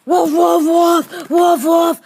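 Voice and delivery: barking dog. Acted. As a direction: bark like an attacking dog. Plays attacking dog.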